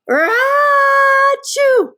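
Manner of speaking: big high loud fake sneeze. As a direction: sneeze out the whole thing. Bear sneezes in story.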